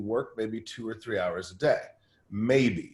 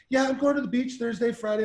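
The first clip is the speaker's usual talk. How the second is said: much higher, breezy staff member; cheery assistant voice; 12 semitones higher than usual. Retold or imitated. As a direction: imitate a carefree assistant announcing a day off.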